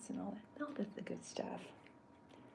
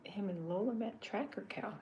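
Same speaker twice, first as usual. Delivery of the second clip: usual speaking voice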